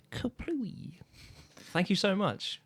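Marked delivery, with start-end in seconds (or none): 0.1-0.9 breathy whispered kaplooey; whispered explosion. Pretend. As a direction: whisper the boom. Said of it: "today's Explosions with [name]".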